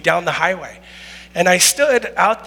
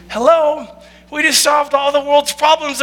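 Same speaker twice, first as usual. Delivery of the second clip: usual speaking voice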